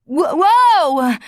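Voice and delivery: loud high whoa as she falls; startled cry. A narrator's reading. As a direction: lose balance, cry out. Adrian topples over.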